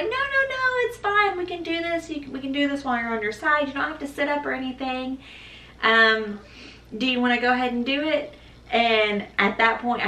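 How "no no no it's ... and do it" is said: much higher, quick upbeat reassurance; reassuring doctor. Retold or imitated. Mother imitating the anesthesiologist insisting there's still time. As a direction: mimic a breezy, brisk anesthesiologist. Anesthesiologist quoted high, fast.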